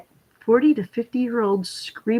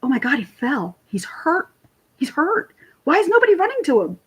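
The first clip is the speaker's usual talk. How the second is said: alarmed; 6 semitones higher than usual. Retold or imitated. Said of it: "I go to my friend, I'm like".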